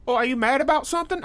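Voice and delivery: high, fast mock Australian accent; hammed-up Australian bloke voice; bad Australian accent. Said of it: "I don't know how to do Australian".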